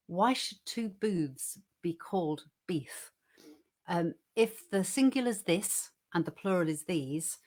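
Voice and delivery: measured, clearly projected poetry reading; poem recital. Acted. Co-host reciting a comic poem for listeners, measured recital. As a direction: recite it line by line with pauses.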